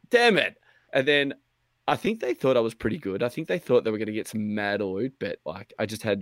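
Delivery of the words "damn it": high, sharp frustrated curse. Retold or imitated. Gamer just killed, high frustrated yelp. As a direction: yelp it out annoyed. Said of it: "I was like".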